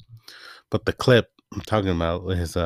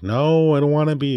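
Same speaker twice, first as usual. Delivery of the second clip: much higher, louder whiny protest; whiny refusal; 8 semitones higher, 4 dB louder than usual. Retold or imitated. Friend refusing to join the game challenge, whining high and loud. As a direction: play the friend squirming out of it.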